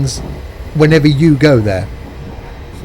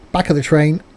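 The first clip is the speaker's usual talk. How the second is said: ordinary talk, nothing marked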